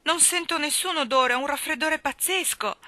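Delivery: high-pitched acted wail of a child; tearful outburst. A narrator's reading. Child excuses herself to guardian for not smelling it, tearful. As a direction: protest as if bursting into tears. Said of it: "Anna scoppiò a piangere".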